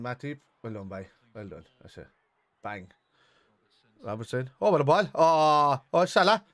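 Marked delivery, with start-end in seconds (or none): 4.6-6.4 sudden loud burst of delight at the pass; excited shout. Real, not acted. Fan watching the match on TV, thrilled shout.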